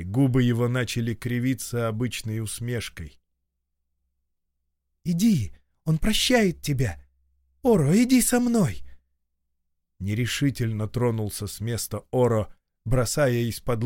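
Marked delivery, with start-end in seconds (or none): 5.0-8.8 joyful calling out. Acted. Leyo calls to Oro again.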